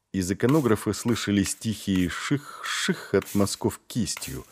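usual speaking voice

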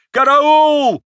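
very high, loud, stretched scream; old man hollering in alarm; wailing cry for help. A narrator's reading. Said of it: "завопил старик".

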